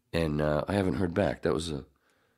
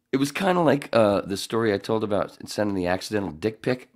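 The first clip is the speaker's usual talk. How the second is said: ordinary talk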